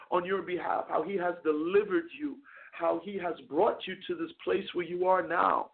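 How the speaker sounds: usual speaking voice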